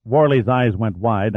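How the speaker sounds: usual speaking voice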